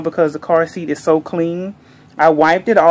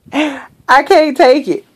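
gushing squeal; 7 semitones higher, slower than usual. Real, not acted. Gushing over reborn doll.

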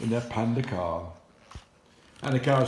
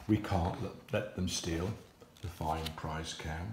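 quiet worried cats; 7 semitones lower, 7 dB quieter than usual. Acted. Cats speak.

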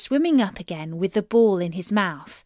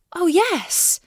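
eager yes; 9 semitones higher, 3 dB louder than usual. Acted. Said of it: "said she".

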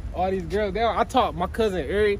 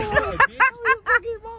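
put-on louder high falsetto girl voice; man doing a woman's voice; 5 dB louder than usual. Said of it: "we gotta practice our girl voice".

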